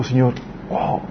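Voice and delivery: breathless hushed wow; hushed amazement at God's work; awed whisper. Retold or imitated. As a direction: let the wonder drop to a whisper. Awed realization in prayer.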